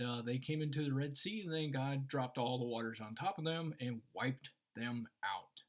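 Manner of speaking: normal talking voice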